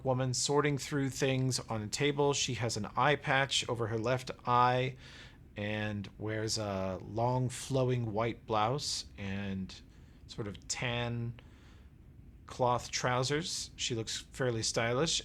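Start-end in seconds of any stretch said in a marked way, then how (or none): none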